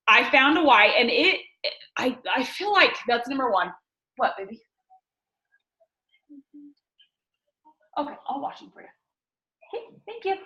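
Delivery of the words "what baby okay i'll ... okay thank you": soft, gentle aside to a little one. Real, not acted. Aside to her small child.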